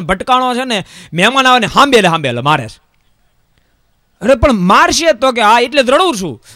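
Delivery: high, lively folk-tale telling; comic storytelling. Acted. Preacher telling the congregation a comic tale of the guest, animated. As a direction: spin the village joke with relish.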